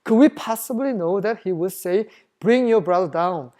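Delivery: slow, measured Bible reading; scripture reading. Acted. Preacher reading the verse to the congregation, slow and measured. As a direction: read each English word out slowly. Reads scripture aloud slowly.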